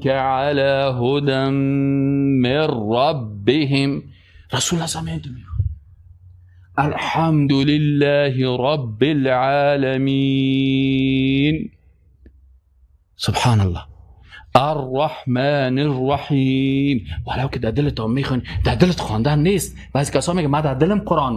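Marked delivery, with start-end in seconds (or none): 0.1-4.0 slow tajwid recitation. Shown as a demonstration. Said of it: "پنج انگشت باید چی شوه؟". 6.8-17.1 slow recitation with pauses. Shown as a demonstration. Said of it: "در دل قرآن خواندن غلط است".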